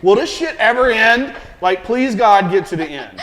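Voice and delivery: loud, high-pitched comic despair; mock exasperated plea. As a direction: beg heaven for it to be over, hammed-up. Acts out fed-up binge watcher pleading.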